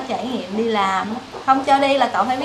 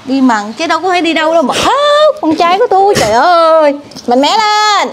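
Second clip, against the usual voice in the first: louder, higher heartfelt urging; emotional urging; 4 semitones higher, 7 dB louder than usual. Real, not acted. Mother loudly urging her son to be strong. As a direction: push him to be strong.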